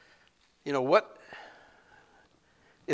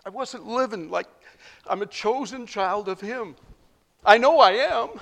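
1 raised confession; 8 semitones higher, 5 dB louder than usual. Real, not acted.

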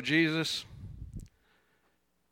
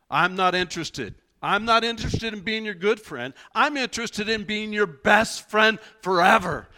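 emphatic declaration; 7 semitones higher, 7 dB louder than usual. Retold or imitated. Jesus voiced in retelling.

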